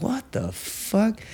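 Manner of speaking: high, soft, slow bewilderment; stunned disbelief. Retold or imitated. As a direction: act out being quietly dumbfounded. Retells own stunned reaction.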